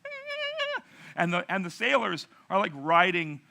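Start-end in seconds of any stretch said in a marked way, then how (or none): 0.0-0.8 very high thin imitated scream; high-pitched retold shriek; mock terrified scream. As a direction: squeal like a scared kid running around. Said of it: "he's running around screaming".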